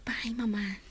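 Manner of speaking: hushed, breathless whisper to her mother; whispered plea. Acted. Daughter whispers to her mother in hushed pain. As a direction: barely voice the question.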